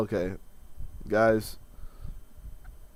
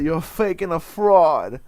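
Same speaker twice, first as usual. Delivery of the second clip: put-on slow, louder Italian American accent, pitched up; Livia Soprano voice; 6 semitones higher, 9 dB louder, slower than usual. Mocking his half-sister in Livia Soprano's Italian American accent. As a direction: drag it out like a scolding Italian American mother. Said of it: "I said it like, uh, Olivia Soprano".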